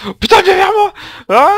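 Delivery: shrieking for help; panicked scream. Real, not acted. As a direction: cry out in terror. Being chased, screams. (hurle de panique)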